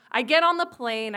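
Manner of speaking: usual speaking voice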